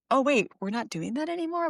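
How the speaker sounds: lightly acted, questioning surprise; imitated puzzled kid. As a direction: play a child caught off guard. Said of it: "have a kid be like".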